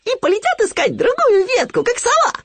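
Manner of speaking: very high, loud piglet squeal; excited realisation. A narrator's reading. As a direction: squeak out your guess eagerly. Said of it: "догадался Хрюня". (догадавшись, громким тонким голоском)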